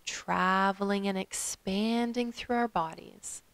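calm meditation guide with long pauses; guided meditation. Acted. Yoga teacher guiding listeners to follow their breath, guided meditation. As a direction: lead them gently through the breath, leaving space.

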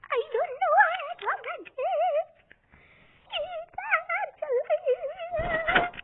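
very high, wobbly squeak; weird squeaky voice. Real, not acted. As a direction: squeak it out in a silly, stretched voice. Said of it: "Why do I even talk like this?".

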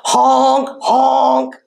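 long honks into a mock ho-ho laugh; hammed-up goose laughter; laughing gander. A narrator's reading. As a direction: honk and guffaw like a noisy gander. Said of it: "the gander laughed".

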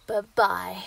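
sing-song the kill; loud mocking farewell. Werewolf killing the hunter, loud taunting farewell.

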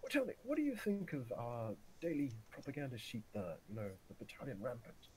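high-pitched imitation of a general; hammed-up general, high then easing down; retold general. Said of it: "the general comes in".

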